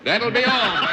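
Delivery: amused mock-stern reply, breaking into laughter; laughing retort. Real, not acted. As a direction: cut him off, then laugh. Host retorts to a joke.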